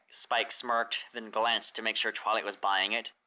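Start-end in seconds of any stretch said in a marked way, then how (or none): none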